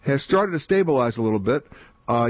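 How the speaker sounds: normal talking voice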